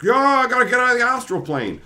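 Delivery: high, loud urgent yell; hammed-up alarm; mock panic. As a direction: blurt it out in a rush to escape. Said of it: "he's just like".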